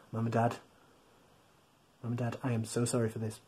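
soft, fast hushed apology to the parents; murmured aside to mums and dads; quiet apology. Real, not acted. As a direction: lean in and murmur quickly.

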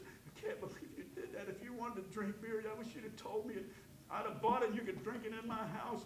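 gently grieved, barely above a breath at first; a father's quiet disappointment; quiet heartbroken father. Retold or imitated.

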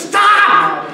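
high, loud snapped stop; stressed mom. Retold or imitated. Stressed mother shouting at her bickering kids, high and loud. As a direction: cut them off like a frazzled mother. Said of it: "the mom obviously was a little bit stressed out".

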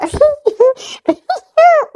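cracking up hard; laughing helplessly; loud laughter. Real, not acted. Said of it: "I can't stop laughing".

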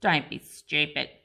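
a low scornful growl; gruff growl. A narrator's reading. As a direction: brush off his brother gruffly. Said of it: "growled Vandal".

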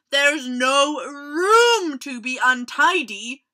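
loud, drawn-out telling-off; snapping crocodile. A narrator's reading. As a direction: snap at the messy tiger. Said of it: "snapped Crocodile".

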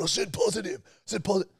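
very high mock shriek; squealed, hammed-up imitation; retold screaming. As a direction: mimic someone screeching a correction. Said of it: "You're just screaming at me".

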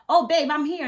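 high, loud, carefree call; breezy announcement. Retold or imitated. Independent wife telling her husband where she is, breezy. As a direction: imitate calling a partner with breezy confidence.

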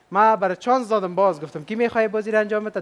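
ordinary talk

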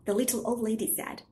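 ordinary delivery, nothing marked

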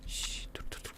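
whispered shh and stop; hushed whisper. Real, not acted. Gamer whispers shh to stay hidden from enemies. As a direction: hush everything, tense. Hushing during tense moment.

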